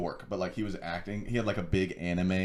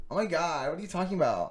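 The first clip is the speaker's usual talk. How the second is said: put-on dumb girl voice; 8 semitones higher than usual.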